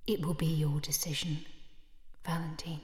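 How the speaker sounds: unvoiced cold whisper; whispered threat. Sister answers his question of death, whispering coldly. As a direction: let it hang, whispered.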